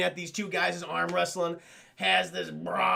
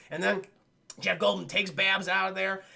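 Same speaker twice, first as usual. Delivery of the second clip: ordinary talk, nothing marked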